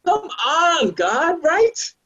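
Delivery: high, loud pleading cry at God; high indignant call to God; exasperated outcry. Real, not acted. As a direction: throw up your hands and cry out.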